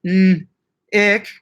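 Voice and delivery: N- then Ick with a pause between; split name. Shown as a demonstration. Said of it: "have the space in between".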